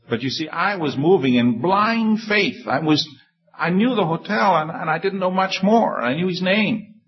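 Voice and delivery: marveling preacher; excited. Real, not acted. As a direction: tell it with growing amazement. Excited recounting of blind faith.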